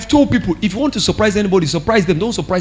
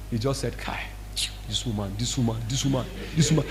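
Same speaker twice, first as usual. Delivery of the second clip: softer, lower, breathless repetition; husband sees pastor's call, whispers in dread; whispered lament; 9 semitones lower, 15 dB quieter, faster than usual. Retold or imitated. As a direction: whisper it like a man too scared to speak up.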